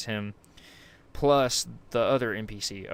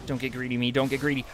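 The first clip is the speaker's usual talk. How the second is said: ordinary delivery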